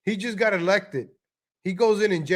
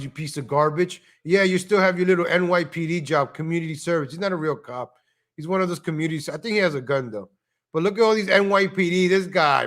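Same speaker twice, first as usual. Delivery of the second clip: normal talking voice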